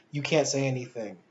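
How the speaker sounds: ordinary delivery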